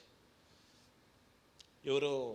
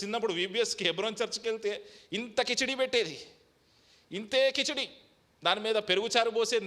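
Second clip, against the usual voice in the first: raised, higher sermon voice; preaching testimony; 8 semitones higher, 6 dB louder than usual. Acted. Pastor recalling church childhood to the flock, raised preaching voice. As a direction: tell the memory from the pulpit.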